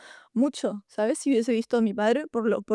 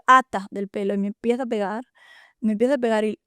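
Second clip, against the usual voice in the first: ordinary talk, nothing marked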